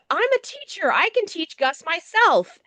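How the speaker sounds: high, chirpy self-assured wife; lightly voiced smug wife; mock smug wife. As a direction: play her all proud and breezy. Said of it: "She's like".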